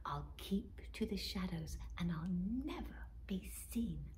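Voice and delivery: sneaky whisper. Acted. Robin whispers her sneaking rhyme to herself. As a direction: breathe it out as if someone might wake.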